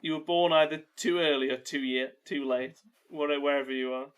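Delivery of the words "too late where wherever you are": usual speaking voice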